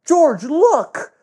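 put-on very high urgent boy voice; excited high-pitched cartoon call; kid calling out. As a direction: squeal it to alert a friend. Boy calls out to George.

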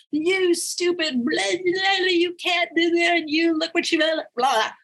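high, slow mock rant with a crying edge; angry critic rant. Reading her angry inner critic aloud to the workshop, mock rant. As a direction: spit out the critic's insults, half-mocking. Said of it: "it was like this".

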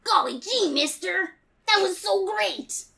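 put-on high, eager gee-whiz kid voice; wholesome 1950s kid. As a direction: gush like an excited farm boy. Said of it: "And I just like, "Golly gee".

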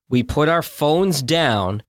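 ordinary delivery

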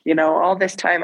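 ordinary talk, nothing marked

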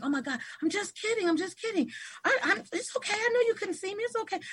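high, retold backpedalling; flustered reassurance. Teacher to grown man weeping, high flustered reassurance.

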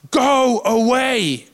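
high, slow holler held on each word; drawn-out shout. Acted. Man stands up to interrupt Jesus, drawn-out shout. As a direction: holler it across a room, stretched out. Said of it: "he shouts at the very top of his voice".

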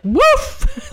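high mock bark; a playful, yappy woof; dog woof. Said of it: "The dog's like".

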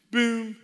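high boom; piano note imitation. Shown as a demonstration. Punctuating the worship song, high boom.

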